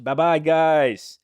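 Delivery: high, loud, drawn-out farewell; slow, stretched hype farewell; called-out goodbye. Real, not acted. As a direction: sing out the goodbye to the crowd. Calling goodbye to live viewers.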